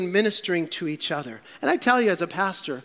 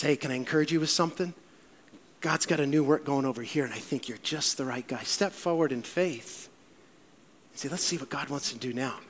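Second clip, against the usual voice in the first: much softer, low coaxing voice; gentle encouragement; 5 semitones lower, 7 dB quieter than usual. Retold or imitated. Barney gently encouraging discouraged Saul, soft and low. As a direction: coax him kindly forward.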